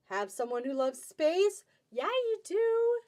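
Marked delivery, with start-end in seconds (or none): 1.9-3.0 excited. Real, not acted. Answering own question.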